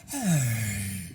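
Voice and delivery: long breathy exhale, all air; airy sigh. Shown as a demonstration. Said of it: "try taking all the air".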